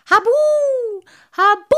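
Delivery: high, loud, drawn-out hooting bellow; long high booming howl; roared spook cry. A narrator's reading. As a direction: bellow it like a ghost to scare someone off. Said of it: "und brüllte".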